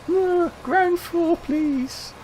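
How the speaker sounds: put-on high, slow startled girl's voice; startled woman answering politely; timid girl. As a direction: squeak it out like a flustered young woman. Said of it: "replaying the girl's reply".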